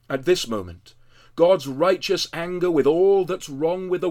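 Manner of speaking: loud, intense sermon proclamation; full-force preaching. Acted. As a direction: proclaim it with fervour from the pulpit. Preaching loudly about Christ's sacrifice.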